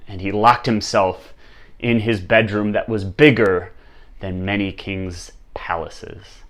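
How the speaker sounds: usual speaking voice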